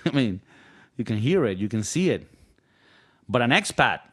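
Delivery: usual speaking voice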